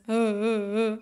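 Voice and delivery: short hiccuping sob sounds; mock sobbing gasps. Shown as a demonstration. Demonstrating her ugly cry to the host, mock sobs. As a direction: act out ugly crying breaths. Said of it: "it's exactly like this".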